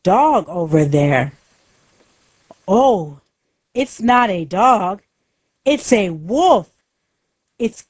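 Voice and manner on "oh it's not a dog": plain reading voice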